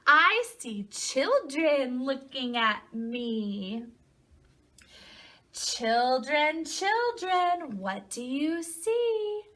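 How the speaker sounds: slow, lilting read-aloud with stretched words; storybook reading to children. A narrator's reading. Teacher reading a picture book to children, slow singsong storytelling. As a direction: read slowly, swooping up on key animal names.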